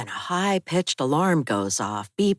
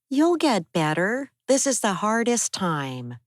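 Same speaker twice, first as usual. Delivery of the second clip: higher, comforting Abby; higher young woman's voice, soothing her husband; Abby's voice; 8 semitones higher than usual. Acted. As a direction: reassure him, in a higher Abby voice.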